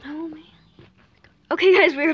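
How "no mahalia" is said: whispering. Real, not acted. Whispered warning to sister.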